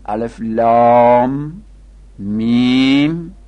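long held vowels on لام and میم; prolonged recitation. Shown as a demonstration. Teacher quoting Quran opening letters, prolonged recitation.

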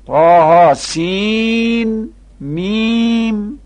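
high chant with long سین and میم; prolonged recitation. Shown as a demonstration. Teacher reciting Quran opening letters, prolonged recitation.

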